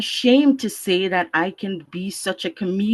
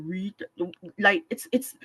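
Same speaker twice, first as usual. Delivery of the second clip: ordinary talk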